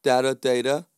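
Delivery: Data first as "datta", then "day-ta", rising; two pronunciations contrasted. Retold or imitated. Doctor dismissing the difference, Data as "datta" then "day-ta". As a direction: say "Data" with short a, then long a. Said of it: "woman doctor said to him".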